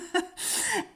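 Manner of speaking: breathy high giggle, unvoiced; a quick embarrassed giggle. Real, not acted. She giggles at viewers about her accent, a high breathy laugh.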